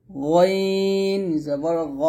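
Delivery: গইন held very long; stretched গইন, rounded "ghaw" on গ; model Ghain recitation. Shown as a demonstration. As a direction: slowly intone গইন, then throaty গ. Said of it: "এরকমভাবে আপনারা সুন্দর করে".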